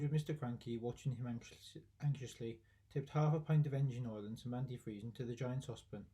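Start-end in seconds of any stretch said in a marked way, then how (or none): none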